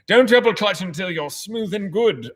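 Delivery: put-on British accent, drawn out; hammed-up slow British accent. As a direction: answer in a silly British accent. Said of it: "silly accents and British accents".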